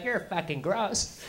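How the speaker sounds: slow, disdainful judgement; old woman silently judging, mild disgust; disgusted look. Acted. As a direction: let the disgust drag out. Old women looking at him.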